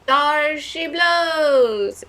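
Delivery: very slow, high, loud yell. Acted. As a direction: call it out across the sea. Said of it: "yelled Gramps".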